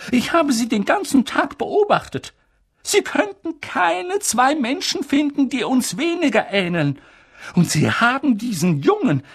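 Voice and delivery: high, loud outraged objection; indignant protest. Acted. Professor objecting to leaving Harry here, loud and outraged. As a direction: refuse it with indignation. McGonagall voiced.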